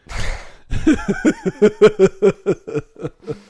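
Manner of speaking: long, high burst of laughter; loud laugh. Real, not acted. Host laughing at his own insult of a film character, loud high laugh.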